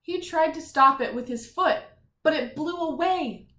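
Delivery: storybook reading with energy; story narration. A narrator's reading. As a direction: read it out for the kids. Reads story to children.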